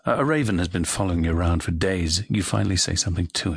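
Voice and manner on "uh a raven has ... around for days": usual speaking voice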